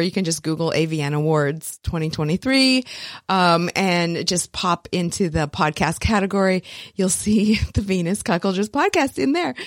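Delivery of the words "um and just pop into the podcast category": usual speaking voice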